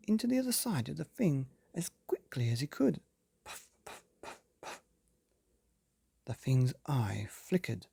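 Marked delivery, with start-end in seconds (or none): 3.4-4.8 puffing breaths. Acted. Blowing air into creature.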